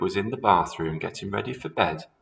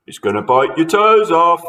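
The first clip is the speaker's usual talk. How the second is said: higher, louder gloating warning; gleeful teasing husband; 8 semitones higher, 9 dB louder than usual. A narrator's reading. Husband scaring wife in bed, gleeful. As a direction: frighten her more. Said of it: "said Mister Twit".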